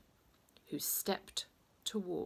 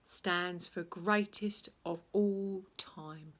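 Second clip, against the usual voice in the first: slow explanation; slower than usual. Acted. Explaining slowly.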